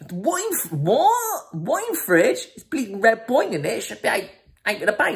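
very high, squeaky disbelief; incredulous outrage. Retold or imitated. Mate hears red wine goes in fridge, squeaky outrage. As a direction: squawk it in comic indignation.

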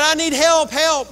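high, loud cry for help; hanging man cries up for help; desperate plea. Retold or imitated. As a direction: beg for rescue. Man hanging from cliff pleading.

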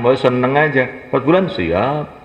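usual speaking voice

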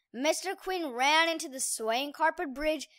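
ordinary delivery, nothing marked

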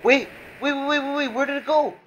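very high, urgent stammered waits; high-pitched alarm; frantic panic. Real, not acted. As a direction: squeal in panic, words tumbling out. Panics, losing track of platform.

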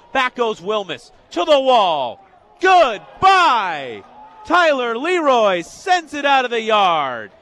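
real sportscaster shout; excited home run call. Real, not acted. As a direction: belt out the homer, drawing out the big words. Calls a home run live.

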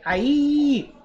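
high, loud shout from afar; loud call. A narrator's reading. Mother calling her daughter home, a loud high shout. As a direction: call out sharply across the forest. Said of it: "Then a loud voice called out".

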